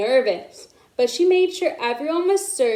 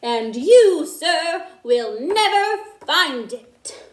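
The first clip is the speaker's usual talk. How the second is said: louder, teasing taunt; pointed challenge; 4 semitones higher, 5 dB louder, slower than usual. A narrator's reading. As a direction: dare him to ever find it. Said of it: "she added".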